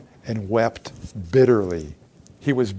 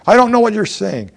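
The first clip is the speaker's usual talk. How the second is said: high, loud protest of not knowing; high loud panicked denial; frantic denial; 10 semitones higher, 8 dB louder than usual. Acted. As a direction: deny it sharply and loudly, cornered. Peter's frightened denial acted out.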